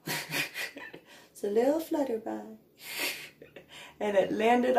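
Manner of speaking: slow, laughing high delivery; laughing as she recalls the butterfly. Real, not acted. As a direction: giggle through the words. Laughing at dog's fright.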